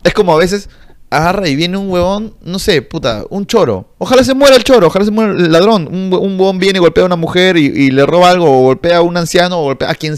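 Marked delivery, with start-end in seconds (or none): 4.0-5.9 loud, higher burst of anger; emphatic outburst. Real, not acted. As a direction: spit the wish out loud. Ranting about thieves.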